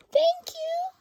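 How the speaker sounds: very high, loud grateful squeal; delighted little boy. Acted. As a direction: squeak it like an excited child. Laszlo voiced in read story.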